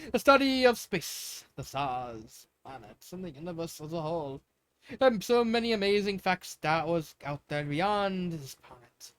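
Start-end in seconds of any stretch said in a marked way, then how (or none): none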